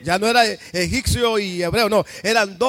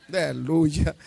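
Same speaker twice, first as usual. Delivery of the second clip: aleluya cantado; 4 semitones lower, slower than usual. Real, not acted. Exclamación de alabanza.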